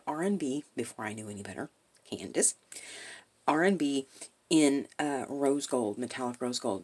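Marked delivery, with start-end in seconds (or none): none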